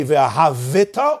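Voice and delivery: v'ahavita with an extra vowel after the V; moving shva in v'ahavita. Shown as a demonstration. Teacher offering two readings, voiced shva in v'ahavita. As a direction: sound out the shva: v'a-ha-VI-ta. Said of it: "V'ahavita is a shvana".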